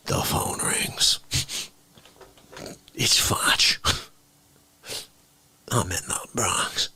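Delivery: slow dramatic whisper. Pretend. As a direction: hush it like a secret. Dramatic phone call setup.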